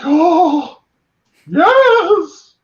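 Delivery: very high, loud, drawn-out yes; ecstatic fan. Retold or imitated. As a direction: squeal with delight. Fan delight at movie.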